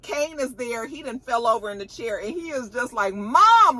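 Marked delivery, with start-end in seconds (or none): 3.1-3.8 high, loud mock cry for mom; retold scream. Son tied to chair sees his mother shot, imitated scream. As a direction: call out louder and higher, like screaming. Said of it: "like, screaming".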